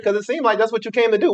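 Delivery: usual speaking voice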